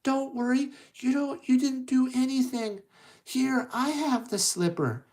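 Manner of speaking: put-on high, sweet-girl character voice; Cinderella reassuring. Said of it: "She goes".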